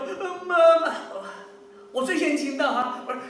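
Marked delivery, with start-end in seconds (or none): 0.1-0.9 high, loud mock wail for mama; imitated crying child. Father imitates his child half an hour later, mock crying. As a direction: put on a child's tearful night-time call. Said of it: "我的老二有时候晚上会哭闹".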